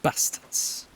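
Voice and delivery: Bastards with short flat northern a; northern English Bastards, clipped a; northern accent. Shown as a demonstration. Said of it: "sounds better in a northern accent".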